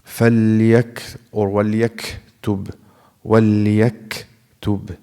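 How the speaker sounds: وليكتب broken by a gap after ك; wrong pause. Shown as a demonstration. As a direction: stop too long on ك before تب. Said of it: "Don't say".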